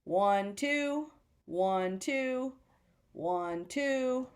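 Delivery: paired counts, each "two" pitched above "one"; counting beats. Shown as a demonstration. Teacher showing there have been two counts, counting beats. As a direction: count off evenly in twos. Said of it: "there's been two counts".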